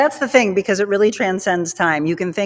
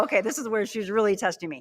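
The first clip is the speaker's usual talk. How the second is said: ordinary talk, nothing marked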